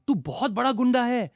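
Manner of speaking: high, loud hurt accusation; a pouting, aggrieved outburst; wounded complaint. Acted. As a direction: accuse her like a sulking child. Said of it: "दुखी आवाज में बोला".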